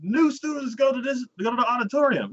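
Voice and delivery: lightly voiced high teacher; a hint of a chipper teacher. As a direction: announce it like a cheery teacher. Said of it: "And he's like".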